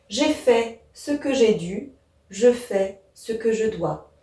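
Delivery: measured, slow delivery with a break after each measure of "J'ai fait ce que j'ai dû"; solemn four-measure reading. Shown as a demonstration. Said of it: "un aspect solennel quand même".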